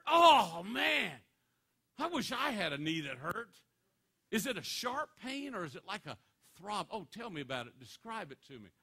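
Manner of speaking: mock excited envy. Bob mockingly envious.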